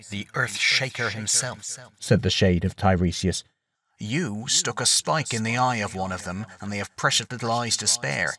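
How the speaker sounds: soft, knowing ghost voice; ghostly prophet. Acted. Prophet's shade tells Odysseus his fate, soft and wry. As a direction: deliver the doom lightly, almost amused.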